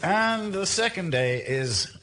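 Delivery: suspenseful announcer pause; slow lottery reveal. Acted. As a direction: drag out the reveal, then drop on the day. Drawing second lottery ball.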